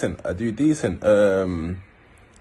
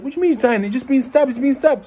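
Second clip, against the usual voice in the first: much higher, frantic disbelief; shocked panic; 14 semitones higher than usual. Retold or imitated. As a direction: gasp the news out in horror. Shocked reply to stabbing news.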